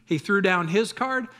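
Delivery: ordinary talk, nothing marked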